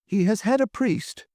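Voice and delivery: high, moved voice; a touch of emotion; not untouched. A narrator's reading. Hotel keeper answering the offer of help, touched. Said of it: "not untouched".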